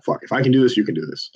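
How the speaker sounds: ordinary delivery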